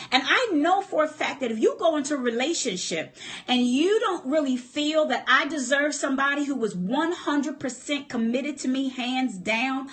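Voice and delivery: usual speaking voice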